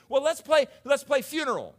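put-on high, loud kid's suggestion; acted playground voice; children proposing a game. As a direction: pitch it up like a child rallying playmates. Acting children proposing another game.

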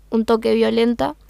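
usual speaking voice

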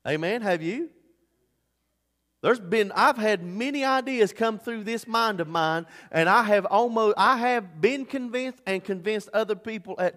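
loud, high, driving preacher's cry; full-force preaching. Acted. Pastor confessing his failures to the church, preaching at full force. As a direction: preach it hard to the congregation.

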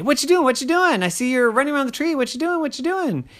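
high, loud pestering squeak; high squeaky nagging; nosy squirrel voice. Acted. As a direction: poke in with questions.

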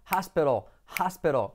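"hospital" as HAS-pero; pronunciación correcta. Shown as a demonstration. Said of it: "Repite conmigo". ("hospital" como «jáspero»)

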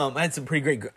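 water down the wrong pipe; clearing a choked throat; coughing up water. Real, not acted. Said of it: "sorry, this water went down the wrong way".

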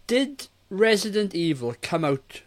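ordinary talk

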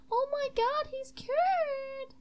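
high-pitched gasp of amazement; gasp, then squeal it high; very high, thrilled astonishment; amazed joy. Acted. Gasps at the successful cure.

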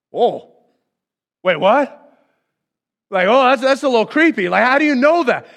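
loud, high, mock-baffled reaction; startled disbelief. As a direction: react as if creeped out, voice climbing. Nathanael startled at being seen.

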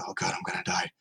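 breathless whisper of mock dread; whispered inner panic. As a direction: hush it like a scared thought. Retold scared inner thought.

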